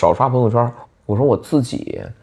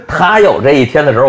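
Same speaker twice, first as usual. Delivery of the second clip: louder, laughing through 他有这一天的时候; teasing a friend while laughing; 2 semitones higher, 8 dB louder than usual. Real, not acted. Teasing about 张成.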